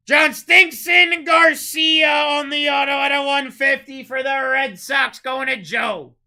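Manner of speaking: booming, slow, drawn-out call of the card name; hype announcer shout. Real, not acted. Breaker hyping a big pull to chat, booming drawn-out shout. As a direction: belt it out like a ring announcer. Auto pulled on livestream.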